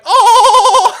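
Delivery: very high, loud, sustained cartoon cry; dude from SpongeBob. Shown as a demonstration. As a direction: blast out one long squeaky note. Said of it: "the dude from SpongeBob".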